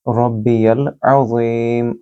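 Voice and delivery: अज़ीम with a throaty ain and a long ज़ी, slowly; slow tajweed. Shown as a demonstration. Teacher drilling the phrase, slow recitation. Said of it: "आइन को भी गले से बोलेंगे और ज़ी को लंबा करेंगे".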